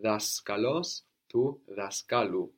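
daskalos stressed on DA, then daskalu stressed on KA, slowly; slow model contrasting DA and KA; teacher showing the accent moving forward; stress shift demo. Shown as a demonstration. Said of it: "This can happen with longer nouns".

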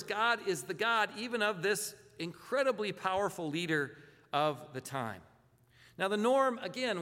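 ordinary delivery

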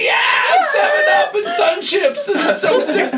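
very high, loud cheer; excited cheering. Retold or imitated. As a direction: cheer like a pack of thrilled kids. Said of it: "everyone's like".

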